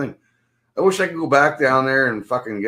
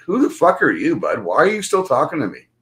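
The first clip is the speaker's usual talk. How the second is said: higher-pitched, indignant challenge; indignant retort; 4 semitones higher than usual. Retold or imitated. Replaying his retort to the stranger, indignant. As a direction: challenge him, pitch jumping on the questions.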